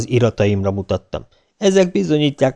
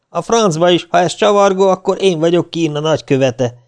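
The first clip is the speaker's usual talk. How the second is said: loud, raised, scornful outburst; bellowing; 8 semitones higher, 4 dB louder than usual. A narrator's reading. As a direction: roar your disbelief at the officers. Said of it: "bömbölte az őrmester". (bömbölő, gúnyos kifakadás)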